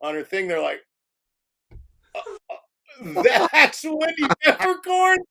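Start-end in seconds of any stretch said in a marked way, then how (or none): 2.2-5.2 put-on stunned boys. Boys gawking at the actress.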